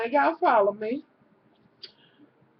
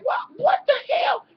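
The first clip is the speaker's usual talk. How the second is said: shocked outcry; 13 semitones higher than usual. Retold or imitated. Retelling the doctor's shock, a high startled cry. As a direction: shriek it like the doctor can't believe his eyes. Doctor reacts to the cotton ball.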